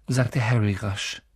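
plain reading voice

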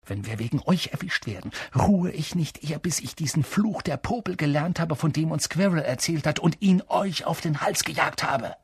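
angry threat. A narrator's reading. Ron, checking the time, threatens Hermine and Neville, angrily. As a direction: threaten them both, contained anger. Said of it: "blickte dann Hermine und Neville wütend an".

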